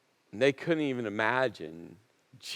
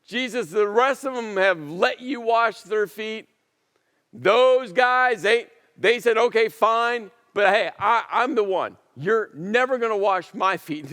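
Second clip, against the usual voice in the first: raised, higher protest, no repeated hard; emphatic refusal; 6 semitones higher, 5 dB louder than usual. Retold or imitated. Peter refuses Jesus washing his feet, loud and high. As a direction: Peter insists, louder and higher, he won't be washed. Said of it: "with all of that just emphatic tension".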